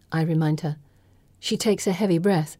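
ordinary delivery

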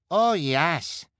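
high, loud cry of agreement; eager cry. A narrator's reading. Spectator agreeing to the violence, high eager cry. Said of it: "cried the woman with dyed hair".

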